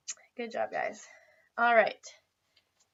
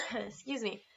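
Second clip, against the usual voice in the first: usual speaking voice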